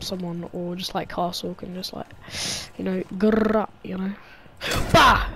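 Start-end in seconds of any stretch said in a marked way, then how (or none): 4.9-5.3 sudden loud bah; frustrated bah. Real, not acted. Frustrated outburst.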